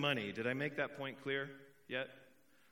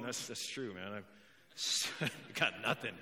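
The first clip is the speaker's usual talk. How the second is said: mock broke listener; 6 semitones lower, 4 dB quieter than usual. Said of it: "there's a couple of you going".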